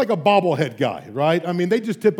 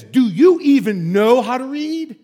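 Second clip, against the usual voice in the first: slow, loud, scornful question; mock stern professor; 4 semitones higher, 5 dB louder, slower than usual. Professor belittling his new PhD student, slow and scornful. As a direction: sneer it down at a student. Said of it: "he basically says".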